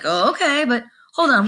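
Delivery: high-pitched, lightly acted interest; intrigued stalling. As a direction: take the offer in, then put them off.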